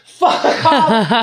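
high, drawn-out mock holler; imitated yell. He imitates people yelling side to side, high mock holler. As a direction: holler it high like a group member.

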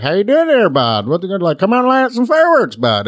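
hammed-up Vermont twang, swooping high; upstate New York accent. Shown as a demonstration. Demonstrating his hometown accent, broad upstate New York twang. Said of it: "there's an accent, like, Vermont, upstate New York".

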